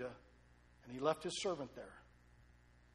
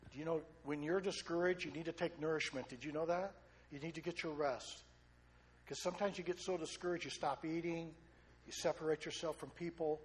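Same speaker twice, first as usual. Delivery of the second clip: normal talking voice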